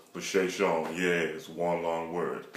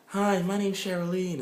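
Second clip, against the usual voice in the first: put-on girly introduction; 12 semitones higher than usual. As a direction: play a woman drawing out her name. Girl introducing herself.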